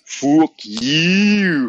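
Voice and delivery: loud, high mock insult; imitating him. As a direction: yell it like him. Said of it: "You sound just like him".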